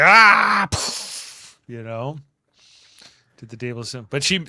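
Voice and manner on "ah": loud, high frustrated shout of "Ah!"; mock angry yell in her quarters; frustrated cry. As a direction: let out a burst of frustration. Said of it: "she's like".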